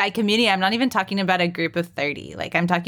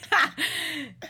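high breathy giggle; 11 semitones higher, 4 dB quieter than usual. Real, not acted. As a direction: burst into a giggle. Bursts out laughing.

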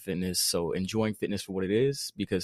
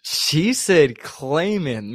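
mocking drawl; 6 semitones higher, 9 dB louder, much slower than usual. Real, not acted. Mocking the word claiming.

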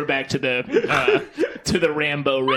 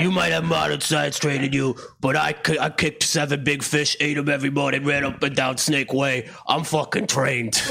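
put-on lower-pitched Philadelphia accent bravado; Philadelphia Goku; 4 semitones lower than usual. Podcaster boasting as new Philly character about his training, Philadelphia accent. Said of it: "It's Philadelphia Goku".

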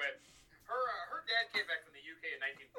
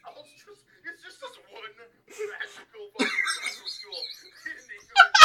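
laughing rant; 14 semitones higher, 14 dB louder, slower than usual. Real, not acted.

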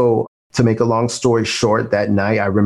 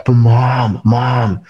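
urgent cry of Mom into the phone, louder and higher; shouting for mom; 4 semitones higher, 5 dB louder than usual. Retold or imitated. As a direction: call out to your mother in desperation. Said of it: "and I'm like".

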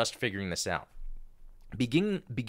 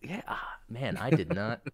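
ordinary delivery

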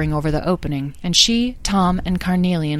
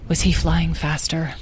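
usual speaking voice

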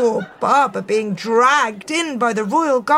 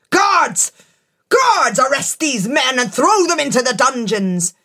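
furious royal command; 4 semitones higher than usual. Acted. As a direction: bellow the order in a rage.

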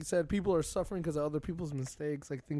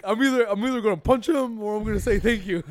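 loud, high laughing rant; amused outburst, pitched up and loud; laughing retort; 6 semitones higher, 9 dB louder than usual. Retold or imitated. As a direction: retell your mock threat through laughter. Comic retelling of his reaction.

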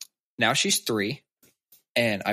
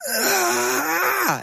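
put-on high, loud little-girl shriek; imitating a daughter's angry shriek; toddler frustrated scream; 5 dB louder than usual. Toddler tensing up, shouting.

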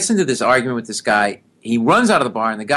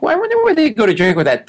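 mock wondering; 12 semitones higher, 4 dB louder, faster than usual. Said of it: "you think".

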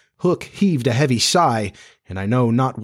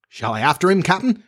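higher, rising hopeful plea; pathetic pleading; 8 semitones higher than usual. A narrator's reading. Smee begs leave to give chase, pathetically eager. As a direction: ask the captain like an eager underling. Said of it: "asked pathetic Smee".

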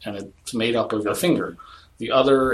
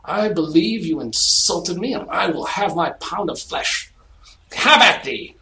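hammy Shakespearean challenge, rising; stagey fake archaic declaiming; 6 semitones higher, 3 dB louder than usual. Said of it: "are we fighting Hamlet?".

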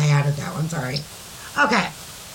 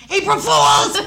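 high, loud prank reveal; shouted punchline; 7 dB louder, slower than usual. Acted. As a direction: yell it as the big gotcha. Delivers the story's punchline.

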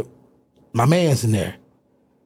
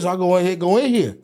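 normal talking voice

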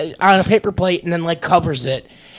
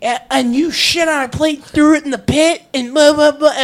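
mocking angry adults; 8 semitones higher, 3 dB louder than usual. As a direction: imitate the furious adults, hammed up. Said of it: "never seen a group of people get so pissed off".

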